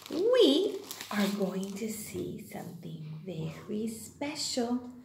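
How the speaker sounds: softly, as if sharing a secret; low, hushed build-up to a surprise; hushed suspense. Pretend. Teacher teasing something special to small children, hushed.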